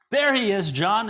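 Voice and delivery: high, loud announcing call; excited call. Retold or imitated. Wife calling to her husband on stage, high and loud. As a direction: sing out to the stage, pointing him out.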